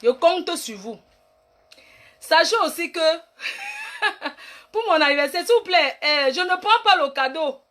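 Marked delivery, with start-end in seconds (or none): 3.4-4.5 high burst of laughter. Real, not acted. Live viewer chat, bursts into high laughter.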